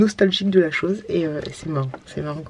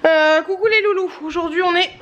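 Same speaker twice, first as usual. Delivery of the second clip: cheery greeting; 9 semitones higher than usual. Real, not acted. Re-greeting after transformation.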